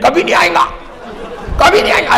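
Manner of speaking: high-pitched imitation of clerics insisting; acted emphatic denial, pitched high; scholars' refusal. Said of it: "Deoband کے علماء جواب دیں".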